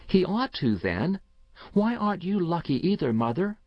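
put-on high-pitched child pressing mother; a hint of an indignant little boy; lightly voiced insistent boy. A narrator's reading. Boy challenges his mother.

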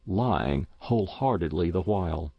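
ordinary delivery

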